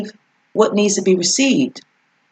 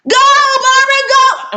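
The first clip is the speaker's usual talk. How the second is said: loud high cheer from afar; mom yelling; 5 dB louder than usual. Retold or imitated. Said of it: "I still can hear my mom".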